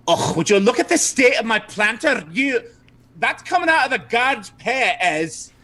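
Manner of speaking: high-pitched indignant madam; Madame Dimitri. Acted. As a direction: scold them in a high put-on voice. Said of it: "Madame Dimitri pipes in".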